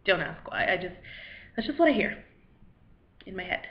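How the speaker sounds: ordinary talk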